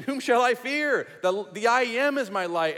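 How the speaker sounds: a preacher's triumphant cry; fervent proclamation. Acted. Preacher reading the psalm to his congregation, a fervent loud cry.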